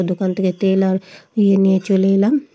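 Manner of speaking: ordinary talk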